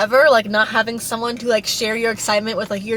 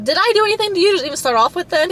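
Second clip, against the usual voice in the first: higher, fast, incredulous comeback; quick disbelieving challenge pitched up; exasperated retort; 8 semitones higher, faster than usual. Retold or imitated. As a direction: fire back at a baseless accusation. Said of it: "I'm like".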